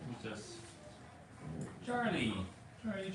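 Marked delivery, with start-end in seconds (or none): 1.9-3.1 bright high call of a dog's name; excited call. Real, not acted. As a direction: call the dog happily, high. Calling dog excitedly.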